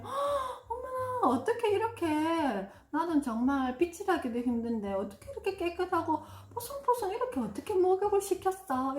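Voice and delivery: high, gasping gushy praise; over-the-top praise. Shown as a demonstration. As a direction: overdo the amazement. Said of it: "칭찬해줄 때도 조금 오버해가지고". (강아지를 씻긴 남편을 과장되게 칭찬)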